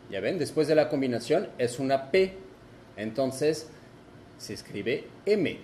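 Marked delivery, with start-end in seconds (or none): none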